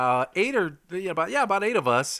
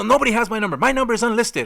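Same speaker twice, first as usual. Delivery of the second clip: high, loud panicky insistence; retold angry caller; 9 semitones higher, 8 dB louder, faster than usual. Caller upset his unlisted number was dialed, loud and high. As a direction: play the caller refusing to believe it. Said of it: "he's freaking out".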